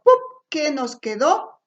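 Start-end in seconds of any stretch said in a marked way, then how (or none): 0.0-0.2 "pup" as a quick puff of p; isolated p sound. Shown as a demonstration. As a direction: say "pup" as just the popped consonant. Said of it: "por el sonido".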